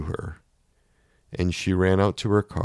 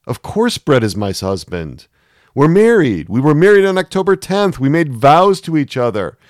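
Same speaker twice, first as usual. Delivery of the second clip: much higher and louder, desperate protest; 9 semitones higher, 11 dB louder than usual. A narrator's reading. Susanna loudly protesting to her inner voice that she is married. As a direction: shout down the doubt.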